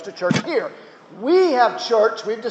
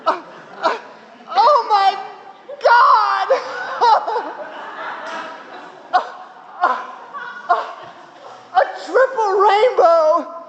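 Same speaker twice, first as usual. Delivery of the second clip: ecstatic laughing awe; 13 semitones higher, much slower than usual. Retold or imitated. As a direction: squeal with giddy disbelief, breaking into a laugh. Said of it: "I copied all this word for word".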